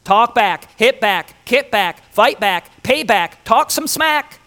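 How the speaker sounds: loud, high, punchy goading chant; acted egging-on, rising to the last; voices of vengeance. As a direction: urge retaliation in hard, separate bursts. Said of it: "we constantly hear those voices".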